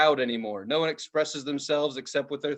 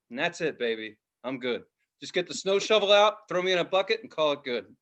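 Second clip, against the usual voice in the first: ordinary delivery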